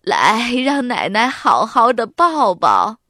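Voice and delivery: loud, put-on grandmother voice; doting grandma. A narrator's reading. Grandma opening her arms for a hug, doting and loud. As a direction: welcome a grandchild into a hug, heartily. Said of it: "索拉奶奶伸开胳膊说".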